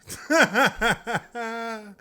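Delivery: a burst of high laughter at the memory; real laugh. Real, not acted. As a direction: let a real laugh break out.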